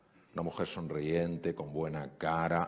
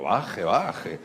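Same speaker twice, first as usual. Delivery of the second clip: loud, playful "calm down"; doctor loudly urges an upset patient to calm down; urging her to calm down; 2 semitones higher, 9 dB louder than usual. Retold or imitated. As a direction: loudly hush her, half joking. Doctor calming an agitated patient.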